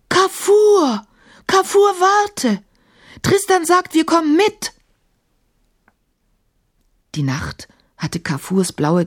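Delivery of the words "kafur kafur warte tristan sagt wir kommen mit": high, loud call after someone. A narrator's reading. Emma calls after the flying genie, loud. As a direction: shout after him far away.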